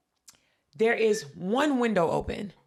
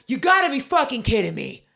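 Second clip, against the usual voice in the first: louder, exasperated grumble; angry old man; 5 semitones higher, 7 dB louder than usual. Retold or imitated. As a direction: complain like an impatient old man in line. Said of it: "He's like".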